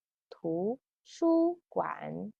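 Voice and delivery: "图书馆" slow and soft, tones clear; slow model. Shown as a demonstration. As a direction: model the new word.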